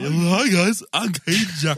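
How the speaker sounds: high, loud dopey dude; dumb guy voice. Acted. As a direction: play the dim-witted hunter, loud and high. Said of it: "I'll be the dumb Cade".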